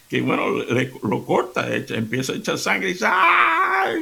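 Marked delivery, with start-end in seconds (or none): 3.1-4.0 high cry of pain from the bleeding husband; mock cry of pain. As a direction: yelp out high and hurt. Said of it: "Empieza a dar gritos".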